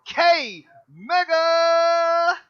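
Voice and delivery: long drawn-out hype shout; announcer shout. Acted. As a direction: bellow the name like a ring announcer. Host announcing guest name.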